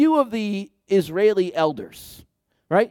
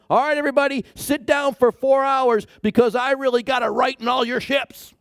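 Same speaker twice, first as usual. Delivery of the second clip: higher-pitched, hammed-up scolding preacher; mock scolding sermon; 8 semitones higher than usual. Preacher mocks God giving a scolding sermon, hammed-up and higher. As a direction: play a fussy lecturing God, pitched up. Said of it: "Is he going to give a scolding sermon?".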